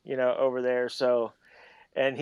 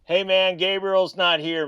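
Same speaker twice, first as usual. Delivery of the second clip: higher, louder, stretched-out doorman put-on; mock turning away; 7 semitones higher, 6 dB louder, slower than usual. Dad pretending not to know his own kid, mock turning away. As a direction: playfully send the visitor away.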